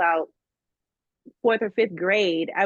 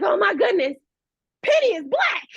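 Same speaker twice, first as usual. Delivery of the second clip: much higher, louder gasp of surprise; amazed discovery; 12 semitones higher, 4 dB louder than usual. Retold or imitated. Kid who just read the credits, amazed. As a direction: blurt it out, thrilled and stunned. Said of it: "And I was like".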